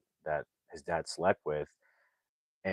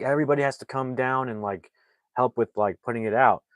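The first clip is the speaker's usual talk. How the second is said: urgent call; 5 semitones higher, 4 dB louder, faster than usual. Retold or imitated. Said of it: "calling everybody".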